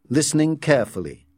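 ordinary delivery

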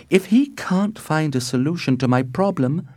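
put-on high-pitched young heroine's private vow; high, grave inner resolve; Juliet, desperate resolve. A narrator's reading.

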